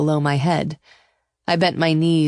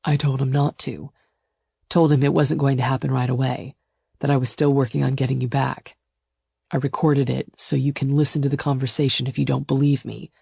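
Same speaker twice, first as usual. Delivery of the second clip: restrained, lightly lowered lover's voice; self-assured male suitor; 3 semitones lower than usual. Acted. Suitor explains his secret recording, calmly assured. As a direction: explain calmly, conceding nothing. Gideon's voice.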